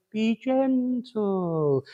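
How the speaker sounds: slow, soft, calming delivery; a slow, hushed calming voice fading to soft; soothing gentle voice. Retold or imitated. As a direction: ease someone down gently, very slowly. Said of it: "emphasize what he's saying with the tone".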